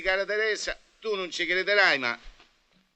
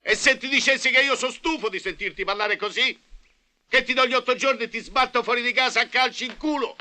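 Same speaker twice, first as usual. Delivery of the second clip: loud, higher angry outburst; angry threat; 8 semitones higher, 5 dB louder than usual. Acted. Master threatening to kick the maid out, furious. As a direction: blow up and threaten her.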